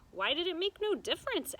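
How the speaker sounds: a hint of a curious young girl; curious child voice. A narrator's reading. Child Abilene's question in story.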